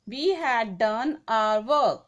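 very slow, each word set apart; teacher reading the example slowly; slow dictation read. Shown as a demonstration. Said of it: "तो यहाँ पे pronoun कौन सा है?".

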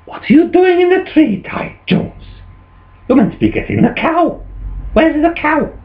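high-pitched, exasperated wife; scolding farmer's wife. Acted. Wife finding farmer up a tree, high scolding.

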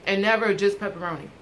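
chewing while talking; eating between words; mouth full. Real, not acted. Seen 0: Holds pizza slice, bit it earlier; jaw chewing motions while speaking.

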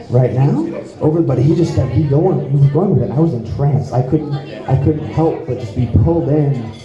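ordinary talk

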